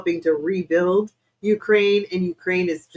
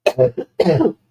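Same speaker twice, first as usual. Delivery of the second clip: throat catching after cough; 4 semitones lower, 6 dB louder than usual. Real, not acted. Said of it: "why I'm coughing".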